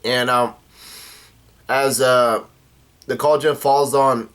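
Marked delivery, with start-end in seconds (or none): none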